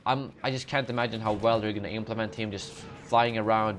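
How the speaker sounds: ordinary talk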